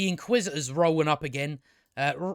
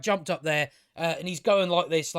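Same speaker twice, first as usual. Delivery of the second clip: usual speaking voice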